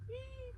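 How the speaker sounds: tiny high-pitched squeal; soft, very high "whee" of delight; excited whee. Real, not acted. Crafter finding she has two skeins, excited squeal. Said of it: "I'm excited".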